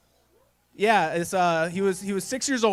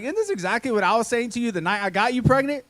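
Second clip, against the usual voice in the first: higher, louder sly dig; cheeky punchline; 6 semitones higher, 4 dB louder than usual. Retold or imitated. Husband jokes back at his wife, cheeky and sly. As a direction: deliver the twist with a smirk. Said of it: "I was like".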